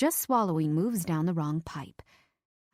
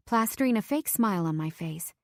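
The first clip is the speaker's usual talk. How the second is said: plain reading voice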